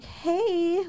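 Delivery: high, soft, sugary hello; high-pitched pretend niceness; mock friendly greeting. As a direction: put on a fake sweet greeting. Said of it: "I just was not gonna be like".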